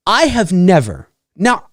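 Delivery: normal talking voice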